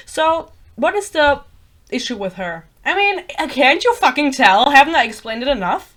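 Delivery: loud, insistent rhetorical questions; exasperated. Real, not acted. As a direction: push it out loud, fed up with explaining.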